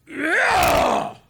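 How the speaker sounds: loud, high growling roar; imitated growl. Gym guy to the man on his mat, growling. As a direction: growl it out like a threatened caveman. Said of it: "again, he growled at me".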